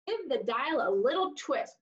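usual speaking voice

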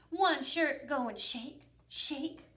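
plain reading voice

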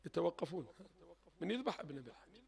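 almost a whisper, low and soft; hushed. Real, not acted. Preacher asking the faithful, near whispered. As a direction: let it fall to a breath.

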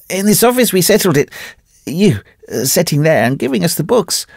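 put-on high, strained, half-mad old wanderer; a wrecked man clutching at his story; ragged Carnehan. A narrator's reading. Broken wanderer telling his old friend the tale, high and strained.

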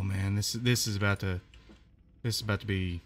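ordinary delivery

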